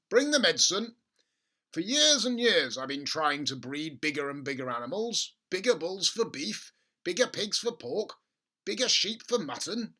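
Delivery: bursting with plans; father excited about bigger animals. Acted.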